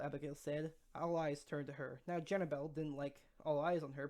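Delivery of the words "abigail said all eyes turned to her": ordinary delivery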